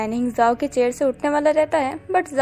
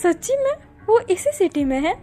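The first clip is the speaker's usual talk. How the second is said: happy; 6 semitones higher than usual. A narrator's reading. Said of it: "अपना जाओ खुश होता है".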